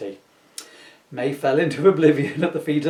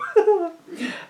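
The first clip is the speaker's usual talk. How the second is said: high giggling fit, can't stop; a high squealing laugh breaking through; helpless high laugh. Real, not acted. Reading a silly story to viewers, laughing helplessly.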